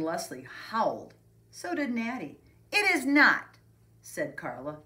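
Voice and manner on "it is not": loud, high protest; indignant retort. A narrator's reading. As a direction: snap back at the teasing. Carla retorts to teasing.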